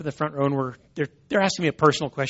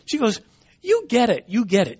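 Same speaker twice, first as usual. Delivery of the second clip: delighted agreement; 9 semitones higher, 3 dB louder than usual. Retold or imitated. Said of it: "And the lady goes".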